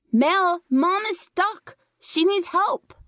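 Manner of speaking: put-on high, slow child's voice, anxious and insistent; pleading little boy. A narrator's reading. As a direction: spell it out slowly for a sister who won't listen. Little brother begs for help.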